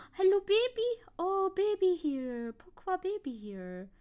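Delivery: high, cooing voice for the dog. Real, not acted. Streamer greets her frightened dog in high cooing baby talk. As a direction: coo at the scared pet.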